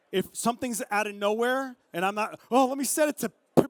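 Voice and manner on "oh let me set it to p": high, loud hurried outburst; mock fluster. Photographer scrambling to set bird detection, mock frantic. Acts out fumbling photographer in a rush.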